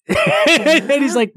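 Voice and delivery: words broken by a laugh. Real, not acted. Laughing while retelling the scene to a friend. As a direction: laugh through the lead-in.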